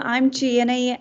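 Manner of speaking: ordinary delivery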